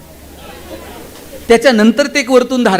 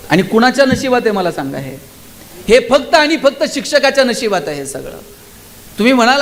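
ordinary talk

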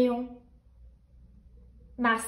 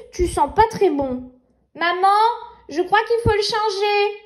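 put-on little girl voice; 4 semitones higher than usual. Nina speaking to baby.

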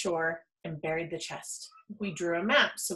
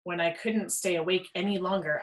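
usual speaking voice

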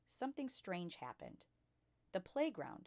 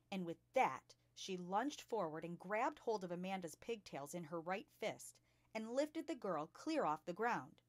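plain reading voice

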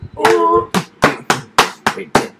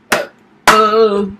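usual speaking voice

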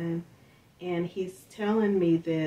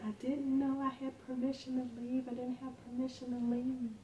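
lightly voiced tearful boy, higher and softer; upset child; 6 semitones higher, 8 dB quieter than usual. Crying son telling his mother, a light tearful child voice. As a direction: plead it like a scared kid.

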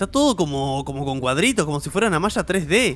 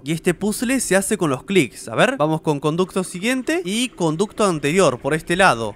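ordinary delivery

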